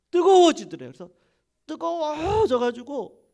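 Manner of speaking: 뜨거워지더래요 shouted high and loud. Acted. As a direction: burst out with 뜨거워지더래요 in a fiery cry. Shouted climax of healing story.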